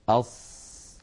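slow, drawn-out أص; repeated sad demo. Shown as a demonstration. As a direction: say أص again slowly. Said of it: "أعيد".

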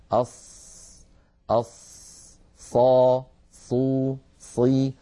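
"أص، أص، صا، صو، صي" one by one with heavy emphatic s; isolated, held syllables "صا، صو، صي" with a full dark s; saad articulation drill. Shown as a demonstration. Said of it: "هذا مكان وضع".

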